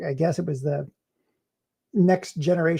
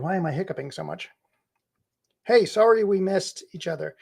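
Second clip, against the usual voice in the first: ordinary talk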